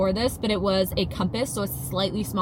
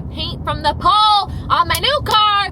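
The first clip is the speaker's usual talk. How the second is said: rising dismay; 10 semitones higher, 6 dB louder than usual. Real, not acted. Upset about scratched new car.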